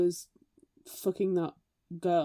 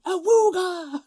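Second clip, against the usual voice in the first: loud, high imitated Awooga bellow; hammed-up game-show Awooga shout; mock Awooga yell; 11 semitones higher, 10 dB louder, slower than usual. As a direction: belt it out like a cheesy presenter. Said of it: "a bad Scottish accent going".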